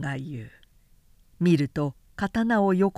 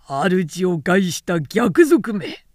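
loud, slow, denouncing cry; slow ringing accusation of a general; accusing shout; 5 dB louder, slower than usual. Acted. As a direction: bellow the charge at the traitor. Conspirators seize and denounce him.

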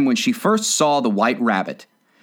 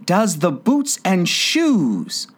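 wandering tone; 7 semitones higher, much slower than usual. A narrator's reading. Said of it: "in a wandering tone".

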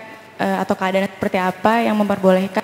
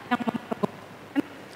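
faint, cutting-out voice off mic; fading away from the microphone; far from mic; 2 semitones higher, 13 dB quieter, slower than usual. Real, not acted. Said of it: "ganti mic, ganti mic".

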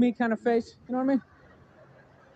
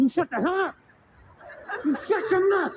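a touch of angry bullying, lightly acted; mock angry dude; 4 semitones higher than usual. Dude holding his girl by the neck, mock angry order. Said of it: "He's going".

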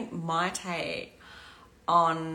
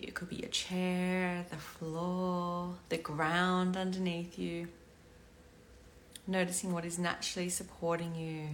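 guided meditation; 6 dB quieter than usual. Acted. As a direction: guide them into the body calmly. Leads a breathing meditation.

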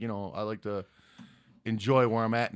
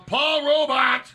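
loud, high, drawn-out name; announcer-style call-out; 13 semitones higher, 8 dB louder, much slower than usual. Real, not acted. Streamer calling out a super chat sender, loud and drawn out. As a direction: belt out the name, stretching it.

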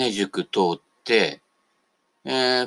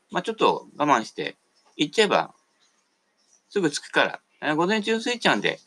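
higher, breezy voice of a buddy inviting you out; casual friend on the phone; 10 semitones higher than usual. Retold or imitated. A friend phoning to pitch a lazy Osaka trip, high and breezy.

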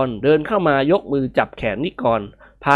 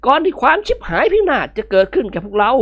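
put-on higher, pressing old man's voice; urgent old master; 9 semitones higher than usual. Old master urging Nikorn to throw it out. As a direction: hurry him out with dread.

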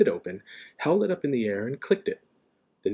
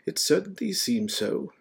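mild wizard, pitched up; Dumbledore; 10 semitones higher than usual. A narrator's reading. Dumbledore answering whether he has gone, mild. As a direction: answer mildly, in a higher voice.

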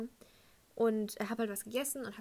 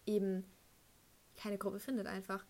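usual speaking voice

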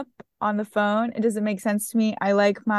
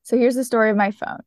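ordinary talk, nothing marked

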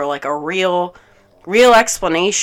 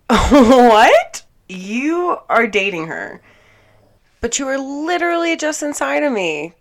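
shocked disbelief; 8 semitones higher, 7 dB louder than usual. Retold or imitated. Hearing her lover is dating someone, shocked and incredulous. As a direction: retell being stunned, pitch shooting up.

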